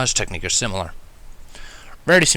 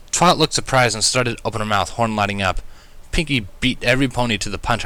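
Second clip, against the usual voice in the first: ordinary delivery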